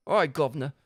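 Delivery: "All right, Governor" in a lightly Cockney voice; mild Cockney greeting; Cockney accent. Shown as a demonstration. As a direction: greet him like a London cabbie. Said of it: "now I can actually do this accent".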